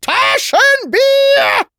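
very high, loud shriek of a name; piercing, drawn-out yell; screaming. A narrator's reading. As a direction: scream for him, stretching the second call right out. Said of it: "Dann fing sie an zu schreien".

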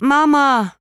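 high, loud yell of Mama from another room; shouting. A narrator's reading. Little sister shouting for her mother from the bathroom, a high loud yell. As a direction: call out for Mama at the top of her voice. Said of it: "schrie Charlotte".

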